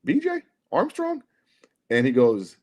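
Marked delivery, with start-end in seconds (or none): 0.1-1.2 excited call-out. Retold or imitated. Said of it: "And I go".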